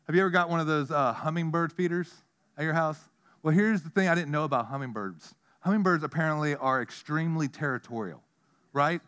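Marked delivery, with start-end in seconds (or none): none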